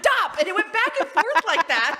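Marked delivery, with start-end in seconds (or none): 0.0-0.4 high, loud teasing "dop"; squeaky mimicry of the wife; mocking echo. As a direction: parrot it straight back. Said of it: "And he went".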